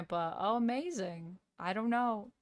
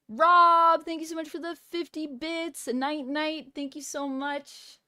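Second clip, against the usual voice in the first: high, loud-starting Aussie thank-you; high-pitched amateur Australian; Australian accent; 6 semitones higher, 5 dB louder than usual. Shown as a demonstration. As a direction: call out thanks in put-on Australian. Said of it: "doing a good Australian accent".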